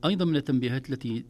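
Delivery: ordinary talk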